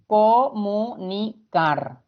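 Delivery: Comunicar said slowly, each syllable apart, CAR stressed; co-mu-ni-CAR dictated slowly. Shown as a demonstration. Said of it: "donde se carga la voz".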